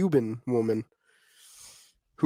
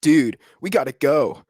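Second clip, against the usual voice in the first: slower, louder bro; louder slow urgent bro line; impression of Steven retried; 3 semitones higher, 6 dB louder, slower than usual. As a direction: lean on it, urgent and drawn out.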